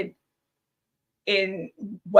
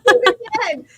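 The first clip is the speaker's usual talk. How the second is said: excited; 13 semitones higher, 7 dB louder than usual. Retold or imitated. Screamed again.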